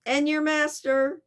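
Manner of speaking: high, loud attempt at a Chicago accent; Mister Fix. Detective presses servant about his master, poor Chicago accent. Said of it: "my very poor attempt at a Chicago accent".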